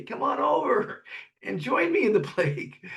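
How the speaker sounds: high, sneering mock-friendly invite; a lightly voiced jerk luring his friend in; mocking invitation. Total ass inviting his friend into the plague, mock sneer. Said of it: "if he was a total ass".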